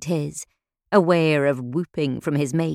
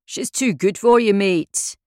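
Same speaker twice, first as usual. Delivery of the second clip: jeering yell; 5 semitones higher than usual. A narrator's reading. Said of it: "yelled as one of the others".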